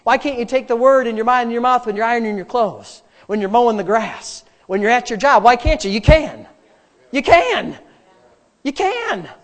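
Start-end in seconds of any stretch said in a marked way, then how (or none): 5.9-9.3 high emphatic exhortation, climbing; rousing insistence. Real, not acted. Preacher urging congregation they can, rousing insistence. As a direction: insist harder and higher each time.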